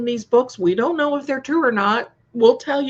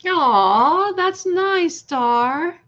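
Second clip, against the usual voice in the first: delighted aww; 8 semitones higher, 3 dB louder, much slower than usual. Real, not acted. Reacting warmly to a viewer.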